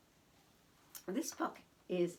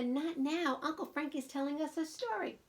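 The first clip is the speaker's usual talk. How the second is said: put-on child's protest; 8 semitones higher than usual. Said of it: "I want to tell Nana".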